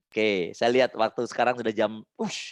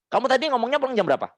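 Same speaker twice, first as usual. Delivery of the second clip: put-on demo mother questioning child; 10 semitones higher, faster than usual. Shown as a demonstration. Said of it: "Anda bilang".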